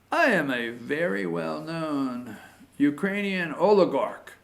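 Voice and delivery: a soft, lightly faked Ukrainian accent. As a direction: put on a hammed-up Ukrainian oligarch accent. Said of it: "I have no Ukrainian accent".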